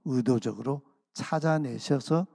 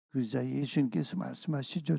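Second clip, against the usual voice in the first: ordinary talk, nothing marked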